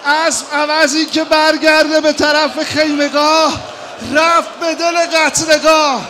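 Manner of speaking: loud, high lament preached at full force; wailing mourning sermon. Acted. Preacher wailing Hajar's grief to the mourners, loud and high. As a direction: cry the story out to the mourners.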